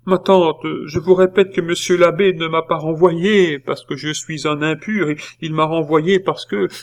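high, insistent explanation; pleading protest. A narrator's reading. Nephew insisting to his aunt on the real reason, pleading.

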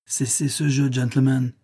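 plain reading voice

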